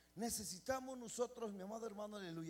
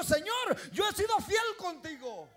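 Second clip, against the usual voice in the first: high, loud cry to God; Ezequías loudly crying out to God; loud plea; 9 semitones higher, 13 dB louder than usual. Retold or imitated. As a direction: call out to God.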